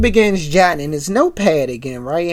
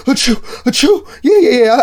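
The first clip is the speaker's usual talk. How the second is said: fake sneezes; 6 semitones higher, 4 dB louder than usual. Pam fakes sick to travel.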